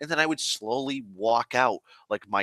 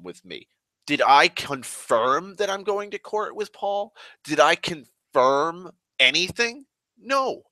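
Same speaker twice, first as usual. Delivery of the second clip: higher-pitched, incredulous demand for answers; indignant challenge; 5 semitones higher than usual. Retold or imitated. Worker confronting the Vietnamese guy, indignant. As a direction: challenge him, pitched up in disbelief. Acted confrontation with the yelling dude.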